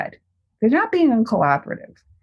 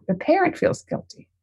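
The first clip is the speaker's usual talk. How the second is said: ordinary delivery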